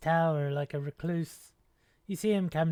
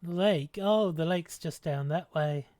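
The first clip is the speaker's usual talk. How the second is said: usual speaking voice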